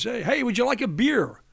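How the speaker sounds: high, loud, friendly American golfer; golfer offering a beer. Retold or imitated. Arnold Palmer offering a beer in the locker room, imitated high and loud. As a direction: call out cheerily to the young Aussie. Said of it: "and says".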